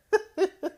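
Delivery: shocked laugh. Real, not acted.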